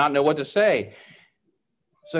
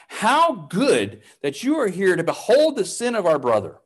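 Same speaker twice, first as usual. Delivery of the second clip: eager call, pitched higher; mild shout; 6 semitones higher than usual. A narrator's reading. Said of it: "he shouted".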